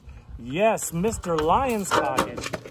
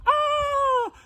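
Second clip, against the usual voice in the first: high squeals easing into a pleading wail; acted frightened scream; 12 semitones higher, 5 dB louder than usual. Missus Pig sees a monster at her door, theatrically scared. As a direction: shriek and fret like a storybook pig. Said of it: "she was so scared, she screamed".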